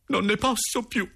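very high-pitched desperate cry; desperate outburst. Acted. Boy alone in his room, crying out in despair. Despairing diary, repeated more strongly.

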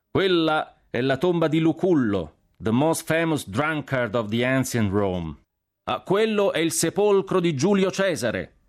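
high, slow, carrying announcements; shouting. A narrator's reading. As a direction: call out each sight to the whole column. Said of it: "gridavo a caso". (gridando a tutta la colonna)

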